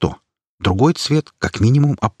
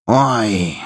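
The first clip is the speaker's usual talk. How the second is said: upset; 3 semitones higher than usual. Acted. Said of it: "расстроенно выдала бабуля".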